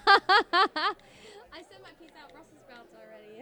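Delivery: laughing through the words, slowly. Real, not acted. Cohost laughing as he repeats his sign-off. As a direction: stretch it out while cracking up.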